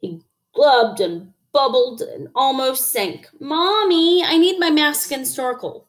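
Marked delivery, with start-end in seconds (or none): 3.4-5.8 high, whiny call to mommy; pleading child. A narrator's reading. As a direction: wheedle like a small child. Child froggy pleads to mother.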